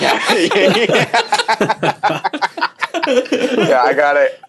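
high, loud laughing yeahs; real laughter spilling into the words; giggling yes, I get it; laughing agreement. Real, not acted. Laughing agreement after the joke.

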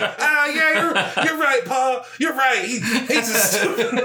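put-on high, laughing childlike voice; giggly high child voice; kid self. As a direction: play the young kid agreeing with grandpa. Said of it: "And I was like".

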